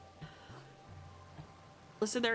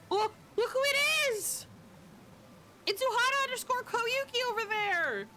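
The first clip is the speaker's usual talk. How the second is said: excited spotting; 12 semitones higher than usual. Real, not acted. Spotting a friend in-game.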